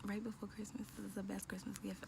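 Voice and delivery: hushed whisper so coworkers won't hear; excited news whispered at the office; whispering at work. Real, not acted. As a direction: keep it to a secret whisper at your desk. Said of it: "I'm whispering 'cause I'm at work".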